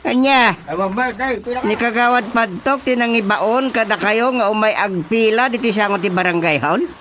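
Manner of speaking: indignant outburst. Acted. Chairman outraged at the councilor's orders, fast high protest. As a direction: demand an answer, outraged.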